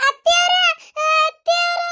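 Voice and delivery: high-pitched scream for mother-in-law; screaming call. Acted. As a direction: shriek out to the mother-in-law in fright. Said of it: "ಈ ನಮ್ನಿ ಚೀರ್ತಾಳಿಕಿ". (ಅತ್ತೆಯನ್ನು ಎತ್ತರದ ಧ್ವನಿಯಲ್ಲಿ ಚೀರಿ ಕರೆಯುವುದು)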